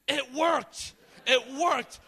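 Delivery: very loud, high-pitched shout. Retold or imitated. Youth pastor reacting to the healing, shouting. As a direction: yell it at the top of your voice. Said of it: "My youth pastor started shouting".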